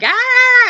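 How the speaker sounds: high-pitched imitated yell; mock kid's yell. Son calling from a second-story window, mock high yell. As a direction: play a kid's holler, very high. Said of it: "he's yelling out".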